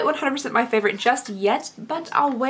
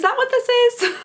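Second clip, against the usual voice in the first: much higher, excited question; squealing disbelief; 13 semitones higher than usual. Real, not acted. Asking her viewers about the full-size find, high squealing disbelief. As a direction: squeal it like you can't believe it.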